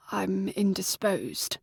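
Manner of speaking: weak, shaky near-whisper. A narrator's reading. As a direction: barely get the words out, trembling. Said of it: "Freddy's shaky voice was a whisper".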